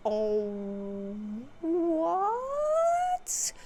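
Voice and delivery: long, drawn-out high "what?"; imitating a stunned crowd; mock astonishment. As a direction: stretch out a hammed-up, high-pitched gasp of disbelief. Everyone reacts to a revival.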